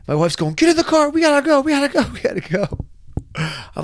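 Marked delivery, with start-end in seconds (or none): 0.5-2.8 high, loud, repeated urgent cry; imitated panicked urging. Husband imitating his wife rushing him into the car, high frantic mock yell. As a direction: mimic a wife urging escape, pitched way up.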